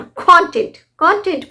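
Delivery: Content with KON punched, falling after; stress on KON. Shown as a demonstration. Teacher recaps the noun, first-syllable stress on content. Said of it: "syllable पर stress दिया".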